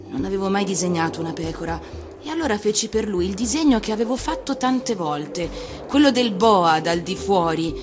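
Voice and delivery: plain reading voice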